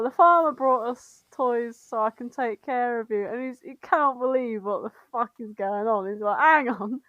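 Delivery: usual speaking voice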